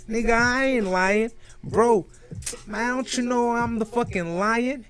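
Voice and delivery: very high, put-on American bragger; acting cool. Poser defending his American story to a doubter, mock-cool high voice. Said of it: "insecure about my accent, so I act cool".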